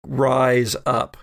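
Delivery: ordinary delivery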